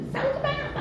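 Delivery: very high, quiet imitation yell; squeaky, held-back scold; mock scolding cry. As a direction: mimic a mother shrieking at squabbling kids. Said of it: "막 이러고 막 이래요".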